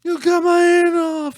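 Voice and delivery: high, drawn-out mock scream; hammed-up shriek of a wounded thug; screaming and yelling. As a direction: yell it slowly at the top of your voice. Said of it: "he's screaming and yelling".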